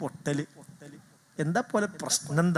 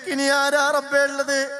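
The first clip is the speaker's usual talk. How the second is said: higher, pleading cry of a grieving wife; imitating the widow's lament, pitched up; wife's wail; 5 semitones higher than usual. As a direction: raise it into a mourner's plea to God. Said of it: "നെഞ്ചത്തടിച്ച് കരഞ്ഞിട്ട് പറയുന്ന".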